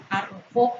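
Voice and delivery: arrojó with weight on the final JÓ; stress on last syllable. Shown as a demonstration. Teacher demonstrating the accent, arrojó stressed on final JÓ. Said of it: "mayor intensidad en la última sílaba".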